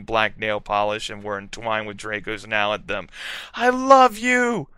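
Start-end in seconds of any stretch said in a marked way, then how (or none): 3.5-4.7 high, slow, swooning confession; saying it to Draco; love declaration. A narrator's reading. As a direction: breathe it out adoringly. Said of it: "I said".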